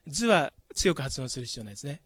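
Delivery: usual speaking voice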